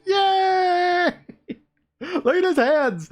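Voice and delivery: high, loud squeal of glee; gleeful cheer. Acted. Happy Pumpkin Bomb stoked for Halloween, high loud cheer. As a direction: cheer like an over-the-moon kid.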